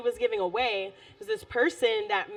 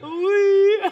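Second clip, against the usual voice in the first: put-on French accent; 8 semitones higher, 9 dB louder than usual. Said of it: "it's a hidden accent".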